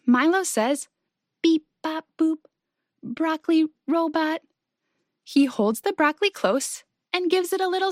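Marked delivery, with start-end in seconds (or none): 1.4-4.4 restrained monotone robot; quiet robot voice. A narrator's reading. Milo trying out the game, quiet robot voice. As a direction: play the robot gently, one level note. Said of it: "in a quiet robot voice".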